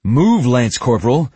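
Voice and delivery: loud, high barked command; shouted order. Acted. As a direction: shout the order across the room. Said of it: "as he shouted".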